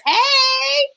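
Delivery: long, high singsong hey; excited greeting. Real, not acted. Greeting viewers from the live comments.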